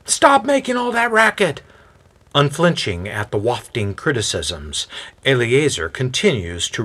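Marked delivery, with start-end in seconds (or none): 0.0-1.6 high-pitched annoyed neighbor yell; irritated shout. A narrator's reading. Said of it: "some yell out".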